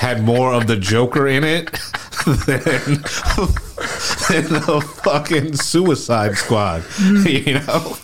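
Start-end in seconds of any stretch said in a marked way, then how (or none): 1.7-7.9 laughing through the words; giggling while speaking. Real, not acted.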